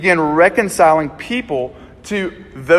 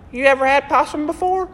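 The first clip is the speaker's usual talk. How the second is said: much higher put-on old country voice; country grandfather; 9 semitones higher than usual. Friend's grandfather offers possum at dinner, high put-on country voice. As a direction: play the teasing old grandfather. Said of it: "He's like".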